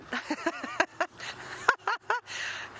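laugh. Real, not acted. Laughs at own stumble.

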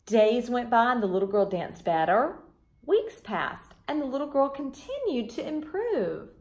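gentle storybook reading voice; children's storybook reader; story narration. A narrator's reading. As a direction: read it like a bedtime story to kids.

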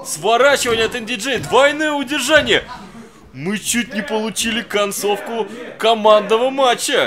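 near-pin excitement, voice jumping up; suddenly hyped, calling the near fall; excited. Real, not acted. Near pinfall in live match. (взволнованно)

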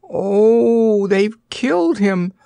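high, gasping horror; Dorothy gasps in horror at the fallen rider; horrified gasp. A narrator's reading. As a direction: gasp it out in shock. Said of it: "gasped Dorothy in horrified tones".

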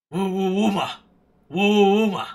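loud hooting bug noise; insect call. Pretend. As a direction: hoot it like a strange creature. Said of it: "what is this sound".

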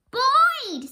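calling a dog to come help. Acted. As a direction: shout the dog's name across the yard.

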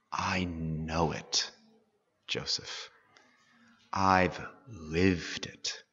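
a father gently breaking hard news to his son; tender father. Acted. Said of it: "It's like he's saying".

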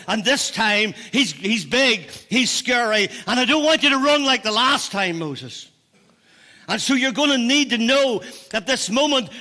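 impassioned preaching. Real, not acted. God charging Moses before he faces Pharaoh, impassioned preaching. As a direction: drive it home in urgent bursts.

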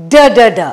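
d, d, d said loud and crisp; phonics d sound. Shown as a demonstration. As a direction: tap out the d sound. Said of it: "We have r".

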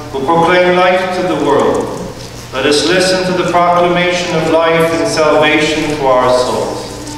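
ordinary talk, nothing marked

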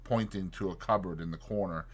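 ordinary delivery, nothing marked

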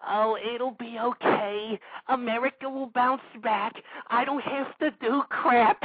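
a put-on dopey voice, the first line slowed; mocking complacent people. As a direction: sneer at them by parroting them.

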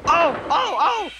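high, pained little cries; crying out. Acted. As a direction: yelp as if struck. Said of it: "cried the van".

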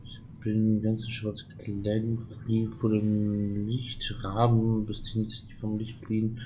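usual speaking voice

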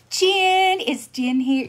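loud, high call for someone. Real, not acted. Streamer looks for Jen among viewers, calling out. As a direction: holler the name to find them.

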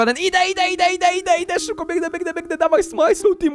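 high, breathless-paced gamer panic; high-pitched frantic chant; frantic urging. Real, not acted. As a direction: race forward, squealing your encouragement. Dashing ahead of the tsunami.

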